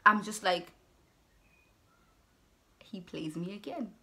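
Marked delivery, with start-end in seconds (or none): 2.8-3.9 quiet, mildly deflated recollection; retold defeat. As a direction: let it drop softly, as if giving up. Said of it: "I was just so defeated".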